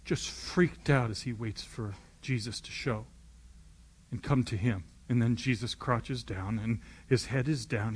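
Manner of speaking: normal talking voice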